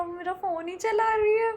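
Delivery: high, tearful complaining whine; whiny near-cry. Real, not acted. Complaining that her friend just keeps using her phone, near tears. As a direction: voice cracking toward tears in frustration.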